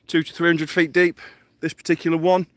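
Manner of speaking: normal talking voice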